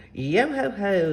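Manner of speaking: slow rolling pirate holler; pirate shout. A narrator's reading. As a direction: hail a shipmate with long vowels. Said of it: "Zelda shouted to Eugene".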